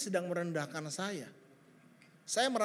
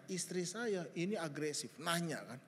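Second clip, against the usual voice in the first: ordinary talk, nothing marked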